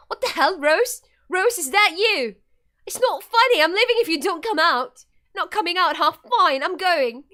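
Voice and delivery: high, loud challenge to someone hiding; acted exasperated outburst; alarmed, annoyed shouting. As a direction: call out sharply, fed up with the prank. Acted outburst at missing friend.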